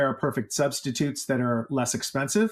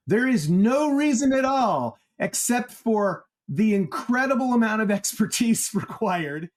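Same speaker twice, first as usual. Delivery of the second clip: heavy sarcasm; 9 semitones higher than usual. Real, not acted. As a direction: lay the irony on thick. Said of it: "with a he-, heavy dose of sarcasm".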